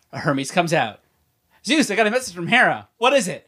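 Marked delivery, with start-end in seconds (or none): 1.6-3.5 higher, acted-out exchange; playful high-pitched dialogue; mock messenger scene. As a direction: play both parts in a raised pitch.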